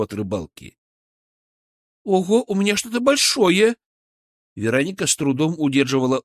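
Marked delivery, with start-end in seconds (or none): 2.0-3.8 high, thrilled cry; startled, high-pitched excitement; excited. Acted. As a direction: call out a surprise big catch. Veronika hooks a big fish.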